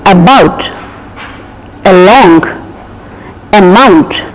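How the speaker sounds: "about" with a weak uh and stress on the second syllable; weak first syllable. Shown as a demonstration. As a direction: keep the first syllable of "amount" light. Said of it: "the first syllable is very weak".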